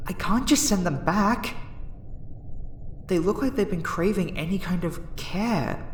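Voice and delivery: torn, pleading. Acted. Researcher torn about sending the lonely subject back, pleading. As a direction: argue with yourself, pitch lifting in distress.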